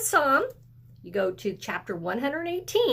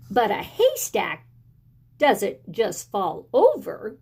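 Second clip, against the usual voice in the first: put-on excited child; 3 semitones higher than usual. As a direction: spill it out like an excited kid. The child tells the wild story.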